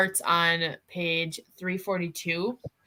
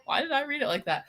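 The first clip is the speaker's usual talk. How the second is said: laughing; 8 semitones higher, 4 dB quieter, much faster than usual. Retold or imitated. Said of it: "I was like".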